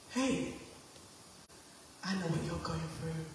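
soft whispery God voice. Shown as a demonstration. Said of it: "in a whisper".

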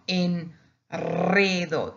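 enredo slowed right down, r trilled after the n; doubled R. Shown as a demonstration. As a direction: roll the r long in enredo. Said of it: "and it becomes double".

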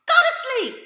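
high, loud, fast scolding mama; sleepy mother. Retold or imitated. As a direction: shoo her off to bed. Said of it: "She said".

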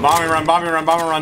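high, urgent warning shout; urgent callout. Real, not acted. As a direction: call out the incoming bomber run. Warning squad of air strike.

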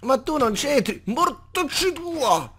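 high, exasperated yell at a mob; angry outburst. Real, not acted. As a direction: curse it out in a high squeal. Mob attacca, lui impreca. (imprecazione acuta e stizzita)

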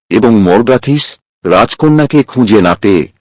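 usual speaking voice

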